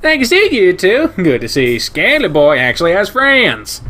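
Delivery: high, loud, brash stranger voice; German shepherd greeting the otter, high and loud; brash shepherd guy. Acted. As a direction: crank the pitch way up and bark it out with delight. Ryan's loud farewell.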